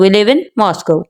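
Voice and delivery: slow statement, pitch dropping over Moscow; falling intonation. Shown as a demonstration. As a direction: bring the end of Moscow down. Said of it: "last syllable है वो down की तरफ चला जाता है". (Moscow का आख़िरी हिस्सा नीचे)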